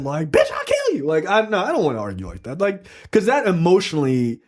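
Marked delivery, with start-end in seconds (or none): 0.3-1.1 high, hammed-up threat; mock furious threat. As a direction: play a partner gone nasty in a fight. Example of a nasty fight.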